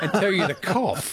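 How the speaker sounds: laughing through the words at an awkward memory. Real, not acted. Host recalling the school nurse exam, laughing. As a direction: giggle it out.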